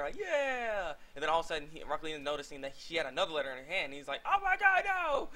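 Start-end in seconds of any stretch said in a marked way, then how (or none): 0.2-0.9 mock cheer. Rock Lee cheers. 4.3-5.3 much higher, louder panicked squeal; mock panic. Rock Lee spotting the other letter, hammed-up high squeal.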